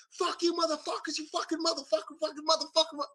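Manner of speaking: high mock-furious cursing; imitating angry friend. Friend phones his pranksters, mock furious cursing. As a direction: imitate the friend raging at them.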